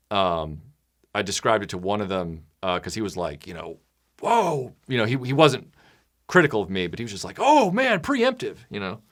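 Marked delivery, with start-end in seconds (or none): 4.2-4.7 mock taken-aback exclamation, pitched up; imitated surprise. Retelling a friend's reaction, mock surprise. 7.4-8.6 high-pitched mock amazement; recoil, pitched well up; imitated surprise. Friend reacting to the speaker's warning, high mock surprise.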